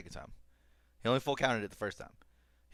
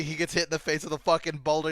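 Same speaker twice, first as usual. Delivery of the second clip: usual speaking voice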